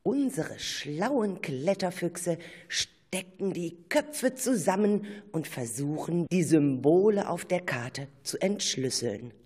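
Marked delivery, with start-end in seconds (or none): none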